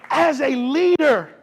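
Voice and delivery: loud, high sermon exhortation; fired-up preaching. Real, not acted. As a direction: punch it out to the congregation with force. Preacher warns future leaders, fired up.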